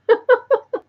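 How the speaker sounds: high, giddy little laugh; excited giggle. Real, not acted. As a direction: giggle with delight. Giddy after joy box news.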